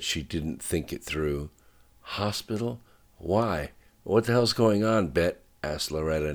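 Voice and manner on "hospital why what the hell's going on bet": alarmed, suddenly alert; sharp and awake. A narrator's reading. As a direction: snap awake, demanding answers. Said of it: "now sharp and awake".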